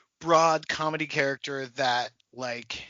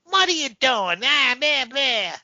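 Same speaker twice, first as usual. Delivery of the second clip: higher, loud hammy cartoon voice; broad comedy character; 7 semitones higher, 3 dB louder than usual. Actress overdoing a comedy role, hammed-up voice. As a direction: overact it into babbled nonsense. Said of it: "she coulda gone like".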